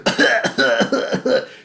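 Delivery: loud, hammed-up coughing fit; mimicked burst of coughs; imitated coughing. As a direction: cough repeatedly, acting out her fit. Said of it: "she started coughing in my face".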